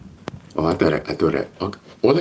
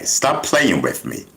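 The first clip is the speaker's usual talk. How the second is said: usual speaking voice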